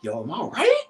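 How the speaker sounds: rising, panicky inner voice ending in a high whisper; jealous boyfriend's panicked thoughts, whispered at the end; anxious inner monologue. Retold or imitated. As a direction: spiral into worry, fading to a frightened whisper. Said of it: "I'm like".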